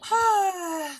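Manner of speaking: high, long falling sigh; exasperated sigh. Real, not acted. Exasperated sigh before commenting.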